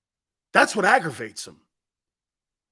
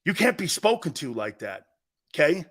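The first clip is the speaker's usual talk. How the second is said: ordinary talk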